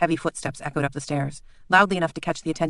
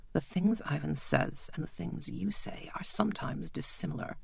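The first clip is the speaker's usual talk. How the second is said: quiet menacing doctor; 2 semitones higher, 9 dB quieter than usual. Acted. Bleak quietly reminds the lord of their deal.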